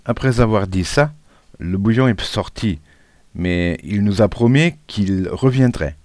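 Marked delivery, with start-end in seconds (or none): none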